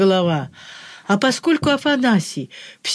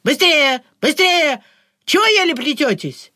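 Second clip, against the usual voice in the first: shouting guards; 5 semitones higher than usual. A narrator's reading. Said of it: "хлестали окрики".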